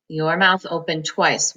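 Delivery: ordinary talk, nothing marked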